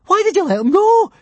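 high, loud panicked shriek. A narrator's reading. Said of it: "shrieked Fishlegs".

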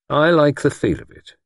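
ordinary delivery

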